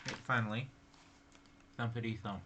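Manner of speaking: ordinary delivery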